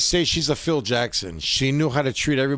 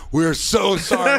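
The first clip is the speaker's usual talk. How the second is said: mock gushing apology; 4 semitones higher, 4 dB louder, slower than usual. Staff apologizing in retold story.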